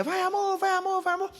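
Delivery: mimicking his wife cheering. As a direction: ham up the wife's shrill little cheer from poolside. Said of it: "minha mulher".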